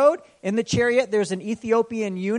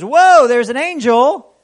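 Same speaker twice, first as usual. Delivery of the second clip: 1 amazed exclamation; 6 semitones higher, 12 dB louder, slower than usual. Retold or imitated. Balaam sees the angel.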